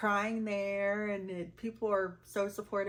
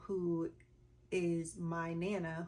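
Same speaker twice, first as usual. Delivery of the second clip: ordinary talk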